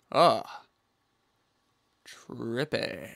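ordinary talk

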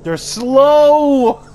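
loud high yell; shouting. Real, not acted. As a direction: shout the warning. Yelling warning mid-game.

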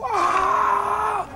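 high, shouted crowd cheer; crowd roar imitated. Arena crowd cheering the announcer, mock roar. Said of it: "they go".

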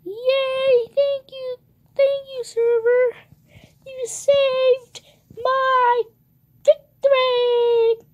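very high, loud, stretched-out squeal; gushing mock celebration. Trapped player thanking the server for victory, gushing squeal. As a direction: squeal thanks, drawing out the last words.

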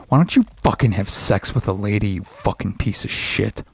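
normal talking voice

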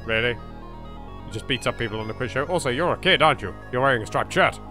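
ordinary talk, nothing marked

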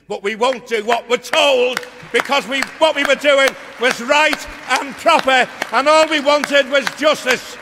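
slow, high, loud defiant roar; rousing shout. Real, not acted. Telling the union crowd they were right, defiant shout. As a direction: hammer it home to the crowd. Rousing climax of rally speech.

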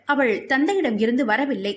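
ordinary delivery, nothing marked

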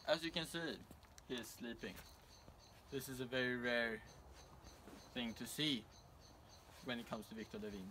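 mock TV reporter read, upbeat and measured; news reporter. Reporter hands back to the studio in a news read. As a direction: play the roving field reporter on air.

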